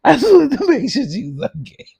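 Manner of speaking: can barely get the words out for laughing; laughing high squeal. Real, not acted. Laughing repeat, high and loud.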